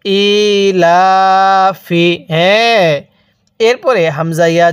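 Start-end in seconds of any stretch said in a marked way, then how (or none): 0.1-2.1 ইলাফি read very slowly, each long vowel held one alif; slow tajweed-style elongation of ইলাফি; drawn-out recitation. Shown as a demonstration. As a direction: stretch ইলাফি into a slow Quranic chant. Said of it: "তাহলে কিভাবে পড়ব?".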